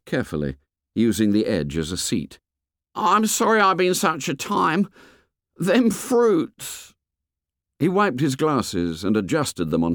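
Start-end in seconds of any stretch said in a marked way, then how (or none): 3.0-6.9 high-pitched schoolboy character voice; fat boy's voice. A narrator's reading. Fat boy apologises on returning to Ralph, high boyish voice. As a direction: voice a boy, pitched well above the narration.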